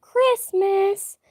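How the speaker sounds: high, loud burst of joy; excited shout. Real, not acted. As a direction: blurt it out thrilled. Excited announcement to chat.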